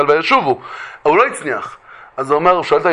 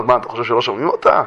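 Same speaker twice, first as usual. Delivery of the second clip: lower, quick retort; low rhetorical question; 5 semitones lower, 3 dB quieter, faster than usual. Retold or imitated. Heaven answering the pleading husband, low quick rhetorical question. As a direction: toss the question back low and fast. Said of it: "אומרים לו".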